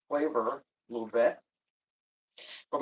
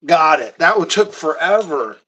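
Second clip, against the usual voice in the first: relieved triumph; 2 semitones higher, 11 dB louder than usual. Real, not acted. Jar finally opens.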